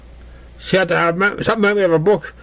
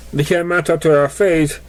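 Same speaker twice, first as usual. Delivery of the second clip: ordinary delivery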